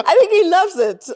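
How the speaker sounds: high and loud through laughter; laughing. Real, not acted. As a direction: laugh while saying it. Laughing take.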